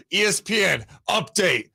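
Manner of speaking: announcer voice. Pretend. Announcer-style mock alert.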